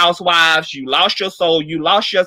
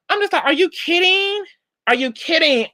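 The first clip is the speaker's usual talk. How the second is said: high-pitched disbelief, are you kidding; incredulous; 10 semitones higher than usual. Real, not acted. Vlogger to Nene, high incredulous are you kidding. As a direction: rise up high in stunned disbelief. Disbelief at Nene's fake crying.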